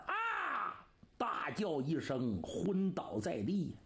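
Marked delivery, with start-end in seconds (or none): 0.0-0.8 crying. Acted. Narrator voices Li Yuan's despair.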